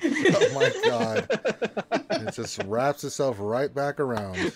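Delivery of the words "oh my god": slow, breathless laughter; cracking up in real amusement; laughing. Real, not acted. Cracking up at the joke.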